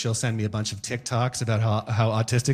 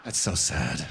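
mock pity; 5 semitones lower, 5 dB quieter than usual.

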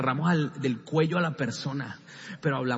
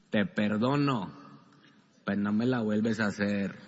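low, grudging voice keeping score; grudging forgiveness; 6 semitones lower than usual. Retold or imitated. Believer keeping a grudge list, grudging lowered voice. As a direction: forgive through your teeth, lower.

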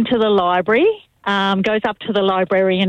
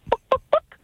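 high clucking chicken voice, much higher. Retold or imitated. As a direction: cluck the words like a hen.

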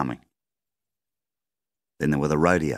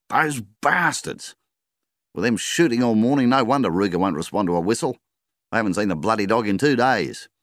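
higher and louder, furious; angry rant; 7 semitones higher, 4 dB louder than usual. A narrator's reading. Farmer ranting to his wife, angry and loud. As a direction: vent about the shooters. Husband rants about shooters.

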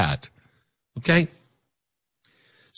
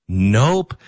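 ordinary talk, nothing marked

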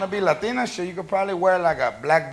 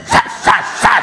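unvoiced smacking sss sounds; slapping sound effect; 3 semitones higher than usual. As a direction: hiss out slap noises.

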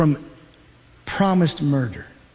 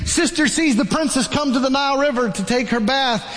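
ordinary delivery

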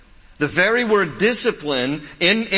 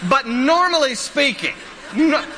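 laughing; 9 semitones higher than usual. Real, not acted. Laughing aside.

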